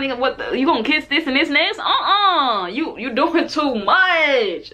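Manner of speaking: high, incredulous telling-off that slows at the end; mock-outraged "uh-uh, you doing too much"; exasperated push-back. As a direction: call him out like he's lost his mind. Telling off a pushy date.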